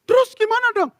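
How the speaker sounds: very high mock wail; hammed-up panicky wife voice; distraught wife. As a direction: wail the question helplessly. Imitated distraught wife asks husband.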